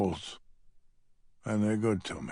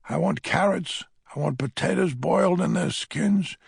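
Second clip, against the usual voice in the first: put-on raised, emphatic old man's longing; passionate craving; 7 semitones higher, 4 dB louder than usual. A narrator's reading. Old man dreams of his wife's pot roast, raised passionate longing. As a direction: demand it like a starving man.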